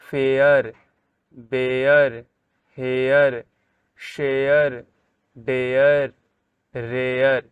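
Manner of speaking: each word in the list stressed: फेयर, बेयर, हेयर; teacher reading the word list out slowly and clearly. Shown as a demonstration. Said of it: "आर ई को अर बोल रहे".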